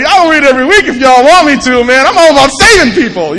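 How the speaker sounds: loud, high, puffed-up eagerness; all excited. Retold or imitated. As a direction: burst out like an overexcited show-off. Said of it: "I'm, like, all excited".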